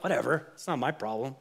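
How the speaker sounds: low, careless shrug of a word; dismissive whatever. Pretend. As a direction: toss it off like it's nothing. God voiced as shrugging off disease.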